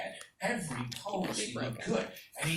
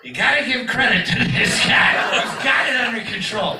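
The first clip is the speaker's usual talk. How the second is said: loud, raised-pitch exclamation; shouted grudging praise; 7 semitones higher, 11 dB louder than usual. Retold or imitated. Kid conceding credit to [name] amid screaming women, shouted. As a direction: holler your respect over a screaming crowd.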